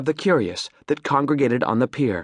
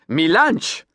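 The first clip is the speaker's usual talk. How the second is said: "Mi lunch!" insisted, higher, Creole "lunch"; Creole accent; 5 semitones higher than usual. A narrator's reading. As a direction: repeat it firmly in Creole. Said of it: "his Creole accent".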